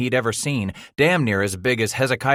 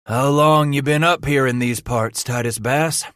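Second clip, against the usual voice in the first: gruff frontiersman; 5 semitones higher, 4 dB louder than usual. Acted. Leader questions captive.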